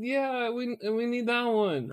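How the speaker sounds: high, girly imitation; imitated wife. Wife choosing lime juice, high imitated voice. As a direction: point it out in a put-on high voice.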